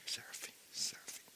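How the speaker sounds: hushed, whispered repeat; whispered echo. Acted. Preacher recalling Isaiah's seraphim, in a whisper. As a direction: breathe the name out very softly. Angels' name echoed in a whisper.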